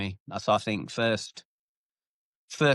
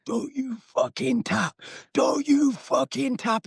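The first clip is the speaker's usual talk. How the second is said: high, slow, drawn-out yell mimicking a furious coach; imitated angry shouting; 13 semitones higher, slower than usual. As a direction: yell the order like an enraged coach. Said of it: "He's shouting at him".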